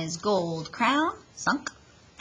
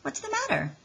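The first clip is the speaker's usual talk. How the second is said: high concerned mother; 13 semitones higher than usual. Acted. Mother asks worried.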